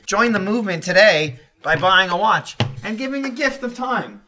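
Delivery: ad read. Pretend. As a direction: sell it like a podcast sponsor spot.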